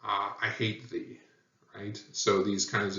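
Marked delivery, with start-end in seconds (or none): 0.4-1.2 a softer, scornful line; mild contempt, not shouted. Shown as a demonstration. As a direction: spit out quiet disdain. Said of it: "or contempt".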